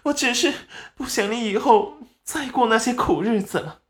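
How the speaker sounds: high-pitched mock crying plea; whimpering, tearful. A narrator's reading. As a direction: whimper pitifully to win your mother over. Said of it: "立即嘤嘤地哭了起来".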